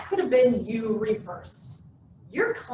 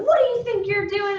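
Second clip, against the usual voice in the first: raised, higher mock scolding; screaming manager; 9 semitones higher, 5 dB louder than usual. Manager to employee who messed up, mock screaming. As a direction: tell someone off in a hammed-up boss yell. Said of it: "the manager screams at him".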